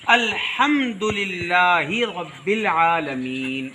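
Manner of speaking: slow Quranic recitation of الحمدللہ رب العالمین; recited prayer. Shown as a demonstration. Teacher shows the prayer recitation to children. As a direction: intone it as in salah. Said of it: "جیسے".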